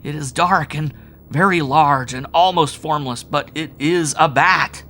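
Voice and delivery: high, loud, slow at the end; terrified sobbing. A narrator's reading. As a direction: fear cracking through. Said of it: "sobbed Howard".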